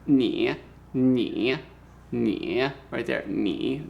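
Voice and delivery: "ni" dipping low in third tone; slow teaching "ni" with a low dip; third tone demo. Shown as a demonstration. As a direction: sink "ni" down, then let it lift. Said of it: "here is the third tone".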